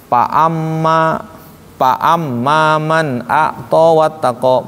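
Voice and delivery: slow recitation with pa amma said using a p; wrong pa for fa. Shown as a demonstration. Teacher demonstrates a wrong p in Quran recitation, slow. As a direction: drawl pa amma with closed lips like a wrong reading. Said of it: "Pakainya pa umpamanya. Salah".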